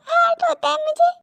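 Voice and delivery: high, pained admission; hurt plea. Acted. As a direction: let the hurt lift the voice high. Hurt woman says it hurts.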